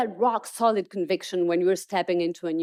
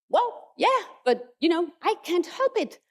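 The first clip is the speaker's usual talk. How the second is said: higher, pleading athlete voice; struggling athlete; 8 semitones higher than usual. Retold or imitated. Athlete to his coach, higher and helpless. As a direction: plead that you can't stop it. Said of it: "And he goes".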